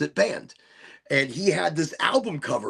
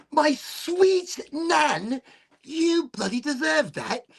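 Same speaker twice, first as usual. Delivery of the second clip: put-on high, slow, sing-song old nan voice; mock sweet nan; 13 semitones higher, much slower than usual. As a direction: drawl it out sweetly like a tiny old granny. Mocking the nan's verdict.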